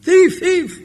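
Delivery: very high mock alarm cry; shouting. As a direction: call it out high, like joining the hue and cry. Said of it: "he's shouting".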